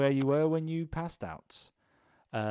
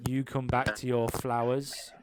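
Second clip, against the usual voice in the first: ordinary talk, nothing marked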